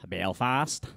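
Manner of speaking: attempted Belfast accent. Shown as a demonstration. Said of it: "You can't do the accent".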